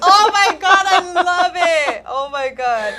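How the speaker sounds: loud and high, laughing through the words; laughing delight. Real, not acted. Laughing at a friend's Xanax joke, delighted. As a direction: crack up and gush.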